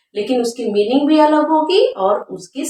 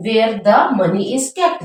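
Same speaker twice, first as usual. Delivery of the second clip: slow teacher dictation; much slower than usual. Shown as a demonstration. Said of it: "दूसरा bank क्या होता है".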